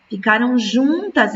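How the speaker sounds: ordinary talk, nothing marked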